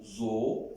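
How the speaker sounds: So with a close o, a drop of u in it; So as a tight, closed "zo"; O with a little U. Shown as a demonstration. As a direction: round the o of So towards u. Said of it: "A little bit U".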